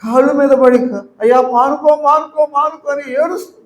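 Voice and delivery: high, loud preacher's cry, building; impassioned preaching. Acted. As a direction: plead with the congregation, voice lifted high. Preaching, pleading quote inside.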